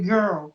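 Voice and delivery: put-on girl said with clenched teeth, tongue cupped; girl with teeth shut. Shown as a demonstration. Teacher showing the trick for girl, teeth closed. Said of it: "mas você não deixa sair".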